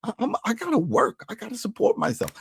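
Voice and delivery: high, put-on defensive voice; stammering start, high-pitched self-justifying; imitated protest. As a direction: plead her case in mock distress. Acted quarrel, her defending herself.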